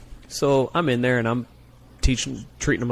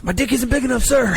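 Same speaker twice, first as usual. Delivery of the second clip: much higher, louder frantic shout; panicked recruit shout; 14 semitones higher, 5 dB louder than usual. Retold or imitated. Flustered recruit shouts his baffling answer, high and loud. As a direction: blurt it out in a panic.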